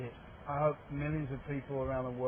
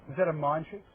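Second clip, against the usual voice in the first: normal talking voice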